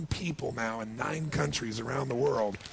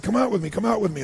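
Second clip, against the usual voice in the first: higher, fast, eager calling out; higher, faster call to come outside; 7 semitones higher, 7 dB louder, faster than usual. Retold or imitated. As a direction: urge the kids out, quick and bright.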